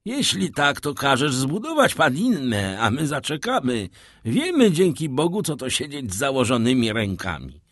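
a loyal sailor brushing the problem aside; eager old sailor. A narrator's reading. Penelon eagerly offers to wait.

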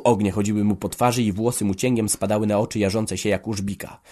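ordinary delivery

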